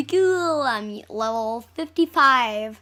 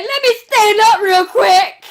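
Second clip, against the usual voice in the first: mock character voice; 7 semitones higher, 12 dB louder, slower than usual. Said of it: "She's like".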